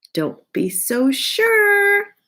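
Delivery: high and slow, knowingly; teasing knowing voice. Acted. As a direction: draw it out with a sly hint. Teacher answers knowingly.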